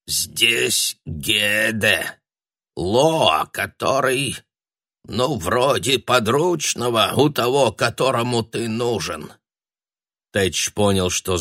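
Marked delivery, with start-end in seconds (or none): 0.1-9.3 put-on slow, trembling old sorcerer; quavering old voice. A narrator's reading. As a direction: creak it out like an ancient man. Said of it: "Дребезжащим старческим голоском".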